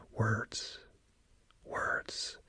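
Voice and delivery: breathy whisper, barely audible; whispered words. Retold or imitated. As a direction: whisper it under your breath, haltingly. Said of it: "she speaks very low".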